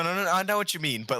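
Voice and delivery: ordinary talk, nothing marked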